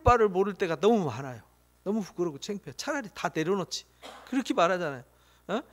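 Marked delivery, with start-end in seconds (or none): none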